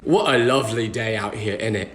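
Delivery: low-pitched imitation British accent; put-on British small talk. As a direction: imitate a friendly British stranger. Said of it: "didn't expect them to have an accent".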